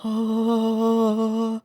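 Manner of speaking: long, high-pitched gasp; drawn-out gasp. Real, not acted. Reacting to the game.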